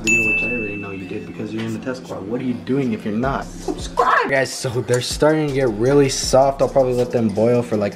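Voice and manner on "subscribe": excited shout. Real, not acted. Playful shout to viewers.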